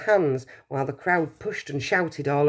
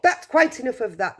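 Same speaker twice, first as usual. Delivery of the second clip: shouting; 7 semitones higher than usual. Acted. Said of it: "shopkeeper shouted".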